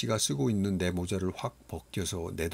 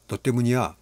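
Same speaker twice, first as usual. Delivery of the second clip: angry accusation; 4 semitones higher, 7 dB louder than usual. Acted. Boy charges and blames him.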